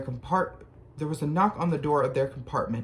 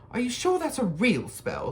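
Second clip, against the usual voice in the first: put-on much higher bossy girl voice; 9 semitones higher than usual. A narrator's reading. Bossy girl asking the boys about a lost toad. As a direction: bustle in like you own the place. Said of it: "She had a bossy sort of voice".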